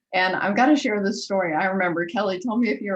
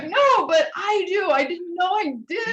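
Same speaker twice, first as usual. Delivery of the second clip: pleading, wound up over it; mock distressed confession; worked up; 10 semitones higher, 4 dB louder than usual. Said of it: "so worked up".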